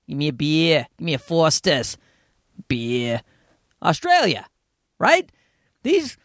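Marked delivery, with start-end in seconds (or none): 0.1-3.2 low-pitched mock Australian accent. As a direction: do an overdone, annoying Aussie. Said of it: "that's how he speaks".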